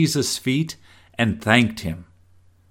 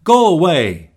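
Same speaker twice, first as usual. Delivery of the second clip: sharp, louder and higher dismissal; upset; 5 semitones higher, 6 dB louder than usual. Shown as a demonstration. Upset person sending someone away, sharp and loud. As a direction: snap it like slamming a door. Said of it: "Someone's upset".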